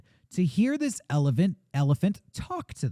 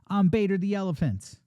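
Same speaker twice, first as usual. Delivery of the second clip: slang-talking elephant; 6 semitones higher than usual. Acted. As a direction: stretch it out like a hip, slangy elephant. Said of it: "it's like a slang talking elephant".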